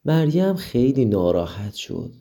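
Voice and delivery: ordinary delivery, nothing marked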